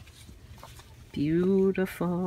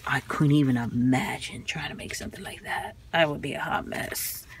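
whispered awe; 4 semitones lower than usual. Real, not acted. Marvelling at old fashion patterns, whispering. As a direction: whisper it as if to yourself. Admiring 1900s fashion sewing pages.

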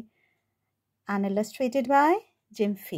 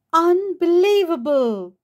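louder, higher, fed-up outburst; exasperated cat; 6 semitones higher, 8 dB louder than usual. Acted. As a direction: throw up your hands like a fed-up cat.

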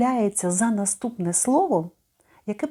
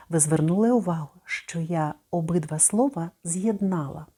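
usual speaking voice

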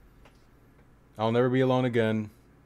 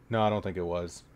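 ordinary delivery

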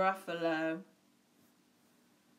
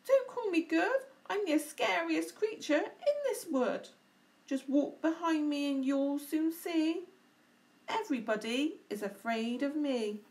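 boastful mouse; 7 semitones higher, 4 dB louder than usual. Acted.

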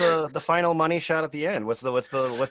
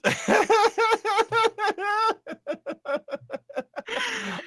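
long laughing fit. Real, not acted.

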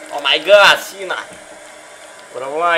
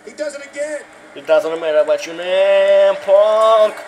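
slow announcer drawl; 4 semitones higher, 5 dB louder, much slower than usual. Pretend. Quotes Cena's catchphrase.

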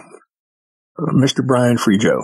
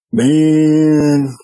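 long drawn-out, higher-pitched "man"; reluctant groan; 5 semitones higher, 4 dB louder than usual. Retold or imitated. Storyteller acts out his reply to the night-time plan, reluctant groan. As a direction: stretch the word out in mock dismay.

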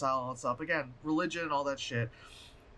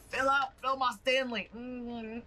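greedy crowd voice; 7 semitones higher, slower than usual. Retold or imitated. Bloodthirsty crowd voice.

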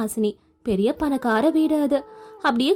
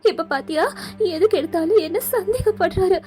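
upset accusation; 4 semitones higher than usual. Acted. Daughter blaming her mother for the marriage, raised and upset. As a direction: blame your mother, voice raised. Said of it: "ரொம்ப பதட்டமா அழுதுகிட்டே ஓடி வந்தா".

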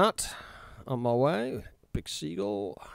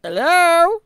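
put-on high quavering old lady calling; old dear voice; 14 dB louder than usual. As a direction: call out like a deaf old woman. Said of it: "the old dear with the hearing problems".

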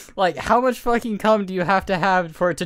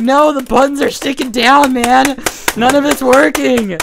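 loud, high mock exasperation; frantic friend, imitated; 5 semitones higher, 8 dB louder than usual. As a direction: imitate a gamer losing it over a broken keyboard. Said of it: "He's like".

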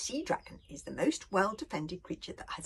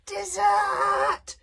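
high, loud far-off dragon shriek; 10 dB louder than usual. A narrator's reading. Fireworm warning the boys of danger, loud high shriek. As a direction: screech it like a panicked little dragon. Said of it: "she shrieked".

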